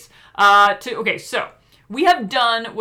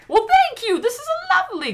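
delighted thanks; 3 dB louder than usual. Real, not acted. Receiving a gift, laughing.

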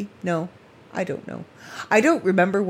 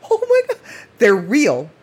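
much higher and louder, gushing delight; thrilled amazement; 6 semitones higher, 6 dB louder than usual. Retold or imitated. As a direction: squeal it as the joy hits. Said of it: "and it's like".

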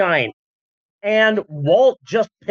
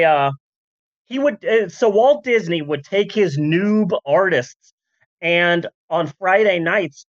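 usual speaking voice

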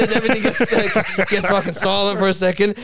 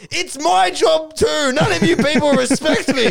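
aggrieved outburst; 5 semitones higher than usual. Retold or imitated. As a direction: act out a wounded complaint at raised pitch. Duck's aggrieved outburst in quarrel.

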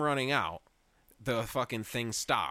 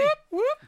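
high rising siren whoops; loud siren imitation whoop; alarm siren whoops; 10 dB louder than usual. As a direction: mimic a fire alarm going off.

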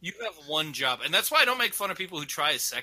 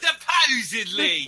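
loud, slow British "Supposedly"; drawn-out British accent "Supposedly"; 8 semitones higher, 4 dB louder, slower than usual. Retold or imitated. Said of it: "just with a British accent".

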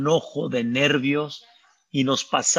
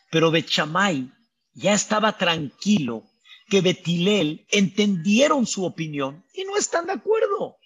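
ordinary talk

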